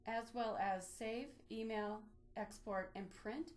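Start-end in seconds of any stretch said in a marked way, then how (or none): none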